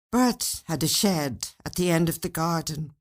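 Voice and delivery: ordinary delivery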